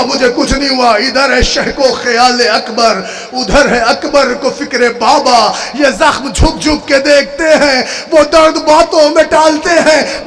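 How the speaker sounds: chanted, high-pitched mourning oration; lamenting zakir reciting to mourners; majlis recital. Acted. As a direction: preach the tragedy at full force, wailing.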